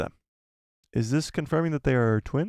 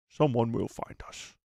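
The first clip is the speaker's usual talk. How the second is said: slow, softer Scottish film-star impression; Sean Connery; 5 dB quieter than usual. Streamer showing what the failed impression resembles, slow Scottish actor voice. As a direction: slur it softly and slowly like an old screen star. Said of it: "That sounds like, uh".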